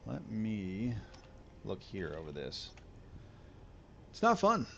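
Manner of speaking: ordinary talk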